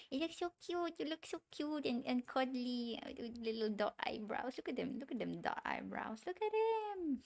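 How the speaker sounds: high-pitched cooing, easing down gradually; cutesy gushing. Real, not acted. Artist fawning over her drawing's dot eyebrows, cutesy gushing. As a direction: coo over something tiny and adorable.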